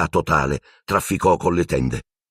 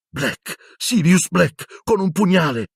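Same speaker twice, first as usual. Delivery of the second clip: put-on high, slow panicked boy's voice; terrified boy; 13 semitones higher, slower than usual. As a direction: gasp it out in fright. Ron terrified after attack.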